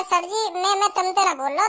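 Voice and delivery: ordinary delivery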